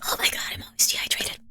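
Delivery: whispered "Oh my God, I'm always dehydrated". Shown as a demonstration. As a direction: breathe the line with no voice. Reads chat twice, first whispered.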